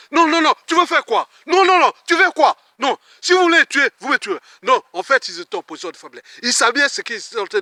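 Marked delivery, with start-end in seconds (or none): 0.1-4.4 beaucoup plus haut et plus fort, furieux; cri de colère. Retold or imitated. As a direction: crie son refus, outré. Dispute rejouée avec la femme. (il crie son refus à la femme, furieux)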